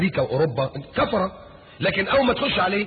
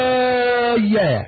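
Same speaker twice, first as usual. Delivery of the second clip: "ومحياي" held long and high; stretched word; 6 semitones higher, 3 dB louder, much slower than usual. Shown as a demonstration. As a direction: sustain "ومحياي" slowly. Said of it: "حياتي كلها".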